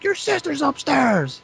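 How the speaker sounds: high, slow mock mother's scolding; mom scolding. Mom scolding son about sister upstairs, high mock voice. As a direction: imitate a scandalized mom, drawn out. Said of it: "She's like".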